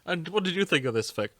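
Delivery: normal talking voice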